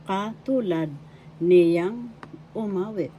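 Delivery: ordinary delivery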